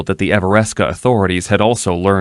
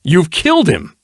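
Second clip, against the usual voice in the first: higher, louder shocked exclamation; exclaimed; 10 semitones higher, 4 dB louder than usual. Acted. Nobleman exclaims at the dead ruffian, shocked. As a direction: gasp it out in shock.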